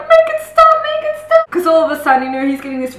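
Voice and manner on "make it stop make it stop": high, loud mock pleading from the villain; hammed-up cry of a tormented villain; villain in agony. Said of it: "Then Oren's like".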